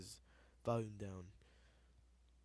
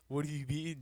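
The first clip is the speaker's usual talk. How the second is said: mock giggle breaking into the words; retold laughing; 4 semitones higher, 5 dB louder than usual. Friend laughing at the accusation, giggling through the words. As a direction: imitate him laughing through the question. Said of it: "Why are you laughing?".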